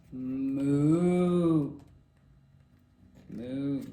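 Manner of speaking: long low moo; slow, low cow call. A narrator's reading. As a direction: draw out a deep moo. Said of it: "asked the cow".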